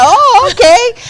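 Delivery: high, loud, startled agreement; mock astonished okay; taken aback. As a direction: react to an unexpectedly long order. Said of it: "I was like".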